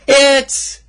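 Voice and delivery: loud, high, slow showman call; announcer hype. Acted. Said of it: "don't, don't do that".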